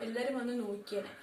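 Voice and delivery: normal talking voice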